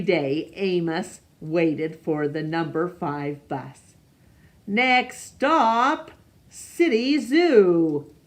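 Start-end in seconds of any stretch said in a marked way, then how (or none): 4.7-8.1 higher, louder, slow call of a bus driver. Acted. Bus driver announcing the stop to Amos, called out slow and loud. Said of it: "the bus driver would call".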